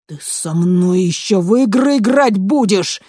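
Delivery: loud menacing threat; sinister threat. A narrator's reading. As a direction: threaten him ominously. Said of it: "зловеще прищурился".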